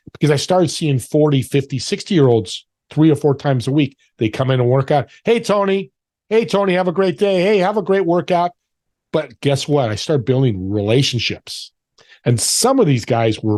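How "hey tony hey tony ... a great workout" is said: higher-pitched front-desk hello, acted out; re-enacted chirpy welcome; cheery greeting. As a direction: call out a friendly greeting to a regular.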